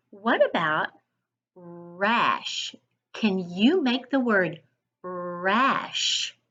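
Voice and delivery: ordinary talk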